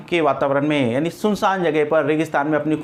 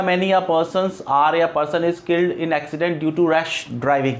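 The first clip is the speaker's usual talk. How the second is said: slow dictation; much slower than usual. Shown as a demonstration. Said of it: "मैंने कहा".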